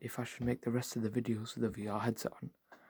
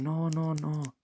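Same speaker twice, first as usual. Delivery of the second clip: higher, pleading no, no, no; panicked no; 5 semitones higher, slower than usual. Retold or imitated. Narrator pleading as the car drives off, higher pleading. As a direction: beg him to stop, pitch up. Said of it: "I'm going".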